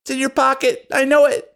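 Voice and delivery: put-on high childish insistence; a little girl insisting on her side of the story; whiny little girl. Gertie argues with Rosa in front of her mom, high whiny child.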